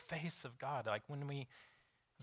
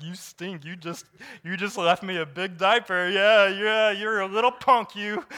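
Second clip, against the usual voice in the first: put-on higher, louder teasing coo to a baby; playful baby talk; 6 semitones higher, 11 dB louder than usual. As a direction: tease the baby lovingly with a big smile. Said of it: "and have a smiling face".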